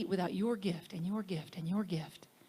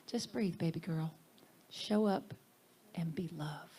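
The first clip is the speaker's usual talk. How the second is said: softer, lower fatherly calm; tender advice; 4 semitones lower, 4 dB quieter than usual. Retold or imitated. Teacher telling her to breathe, tender and soft. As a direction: ease her down gently. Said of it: "So he said".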